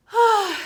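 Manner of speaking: loud, high theatrical sigh; big sigh. Acted. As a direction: heave a dramatic sigh for Santa. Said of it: "Santa sighed".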